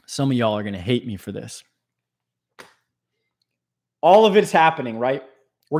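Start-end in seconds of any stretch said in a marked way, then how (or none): none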